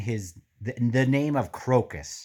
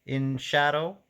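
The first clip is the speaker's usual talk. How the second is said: lightly higher sly put-on voice; scheming Pust voice; 7 semitones higher than usual. Reader imitating Pust scamming the lady, lightly voiced. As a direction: sell the fake ritual with a hint of a con man. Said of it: "He's like".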